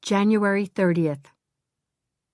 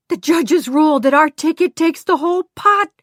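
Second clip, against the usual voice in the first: much higher, louder joyful scream; 8 semitones higher, 7 dB louder than usual. Acted. Glenda telling the group on the beach, screaming with joy. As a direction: shout the news to everyone. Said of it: "she screamed".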